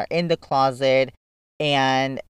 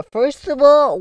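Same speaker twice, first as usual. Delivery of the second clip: sharp, high and louder, offended; indignant retort; 12 semitones higher, 7 dB louder than usual. Retold or imitated. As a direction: put him in his place. Retelling scolding his brother.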